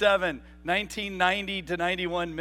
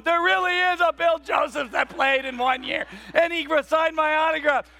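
put-on higher, loud bragging kid; imagined bragging kid; 9 semitones higher, 6 dB louder than usual. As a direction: boast like a kid at school. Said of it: "this kid at school going".

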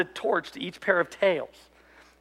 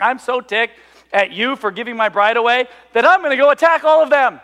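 louder and higher, building to a mock outburst; mock angry rant; 7 semitones higher, 9 dB louder than usual. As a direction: play Samson fuming, rising to a yell. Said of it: "he's like".

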